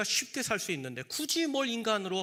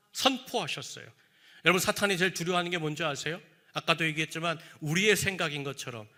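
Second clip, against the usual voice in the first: ordinary talk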